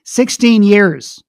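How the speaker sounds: really angry. Retold or imitated. Man repeating himself to the speaker, really angry. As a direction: imitate him snapping, each word slow and forceful. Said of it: "he got really angry".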